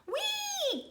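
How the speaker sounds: very high, soft whee of sliding; a gleeful slide sound; playful whee. Acted. Snails sliding for fun.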